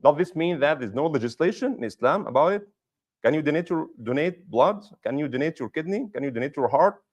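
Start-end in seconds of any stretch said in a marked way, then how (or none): none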